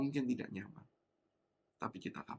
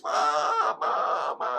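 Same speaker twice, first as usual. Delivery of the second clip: put-on child crying out; 7 dB louder, much slower than usual. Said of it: "Saya cuma teriak, nangis".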